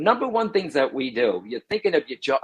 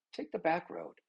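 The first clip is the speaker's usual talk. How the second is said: very soft, like a passing thought; quiet inner voice; 2 semitones higher, 12 dB quieter than usual. Acted. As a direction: barely voice the intuition's nudge. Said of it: "It's just a whisper".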